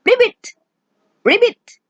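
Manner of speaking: high, short frog ribbits. Shown as a demonstration. Teacher showing what a frog says, ribbiting high. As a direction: imitate a frog, high-pitched. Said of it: "A frog says".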